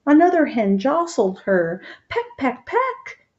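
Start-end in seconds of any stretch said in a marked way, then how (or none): 2.1-3.3 high chirpy pecks, last one leaping up; lightly voiced hen going peck peck. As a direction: play the hen pecking its rivals. Hens jostling on the perch.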